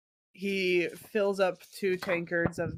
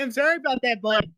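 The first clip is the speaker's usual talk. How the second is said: quick, higher-pitched Canadian apology; mock Canadian accent; 3 semitones higher, faster than usual. Game master apologizing to teasing players, mock Canadian accent. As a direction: play along in put-on Canadian. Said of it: "you just evolve into Canadian".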